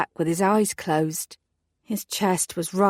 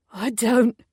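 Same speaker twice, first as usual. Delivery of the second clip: a slight gasp catching on the words; choked up, a touch breathless through tears; 5 semitones higher than usual. A narrator's reading. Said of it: "he gasped".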